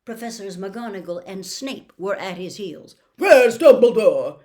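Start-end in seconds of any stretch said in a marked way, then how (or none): 3.2-4.4 much louder commanding bark; demanding. Acted. Minister demanding Dumbledore from Mrs Weasley, loudly. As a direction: demand it of the room. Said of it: "Fudge demanded".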